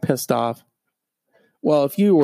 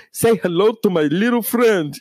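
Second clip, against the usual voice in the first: Scarface impression; 7 semitones higher than usual. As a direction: growl it like Tony Montana. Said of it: "It's almost like Scarface".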